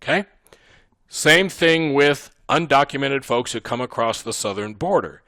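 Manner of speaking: ordinary talk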